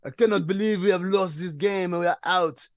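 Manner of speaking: mock sad French accent for a player. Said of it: "there's sad Fournier".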